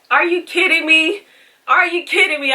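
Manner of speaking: high, loud incredulous outburst; exasperated disbelief. Retold or imitated. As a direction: throw it back at her, can't believe it. Retelling shocked reply to doctor.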